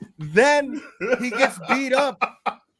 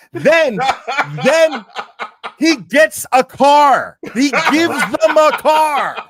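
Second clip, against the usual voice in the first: louder, slow, outraged ranting; louder and slowed down, fuming at the story; indignant outburst; 4 semitones higher, 8 dB louder, slower than usual. Real, not acted. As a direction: spell out the outrage, slowly and loud. Indignant ranting about the gift.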